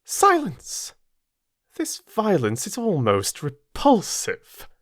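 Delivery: high, cutting rebuke; sharp command. Acted. Mrs Reed cuts off Jane's pleading, sharp and contemptuous. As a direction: shut the child up with contempt.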